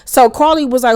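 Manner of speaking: normal talking voice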